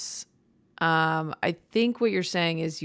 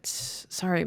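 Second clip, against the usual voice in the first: ordinary delivery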